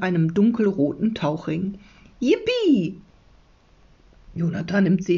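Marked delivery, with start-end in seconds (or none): 2.2-3.0 high, drawn whoop of joy; gleeful storybook exclamation; jubilant cheer. A narrator's reading. Jonathan finds his pencil case.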